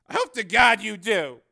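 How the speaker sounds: loud, high exasperated retort; fervent worry. A narrator's reading. Cameraman answers reporter's weak assurance, worried and exasperated. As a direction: say it with anxious emphasis. Cameraman doubts her plan.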